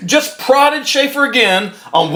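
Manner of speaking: usual speaking voice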